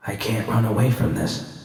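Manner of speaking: soft, resigned admission to herself; quiet defeat; resigned murmur. Acted. V talking to herself in the desert, softly resigned.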